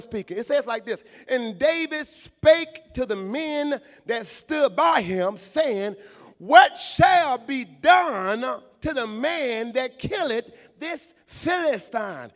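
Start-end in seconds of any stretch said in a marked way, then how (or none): 1.3-12.3 loud, drawn-out pulpit reading; preaching scripture. Acted. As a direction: stretch each word out to the church.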